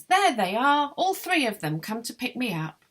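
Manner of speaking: high, loud cry, easing off toward the end; excited exclamation. A narrator's reading. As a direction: exclaim it as you spot them at the window. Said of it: "points to the window and exclaims".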